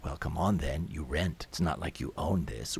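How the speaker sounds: low, soft, impassive worker's voice; indifferent official. Acted. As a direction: dismiss them in a low, unmoved voice. Indifferent mover answers.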